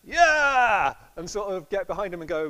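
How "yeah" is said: loud, high crowd cheer; rallying cheer. Retold or imitated. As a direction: shout it like backing a leader. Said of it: "all the other disciples go".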